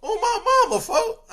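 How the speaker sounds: high laughing vow. Real, not acted. Swearing to viewers it's true, laughing high. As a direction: laugh through the promise.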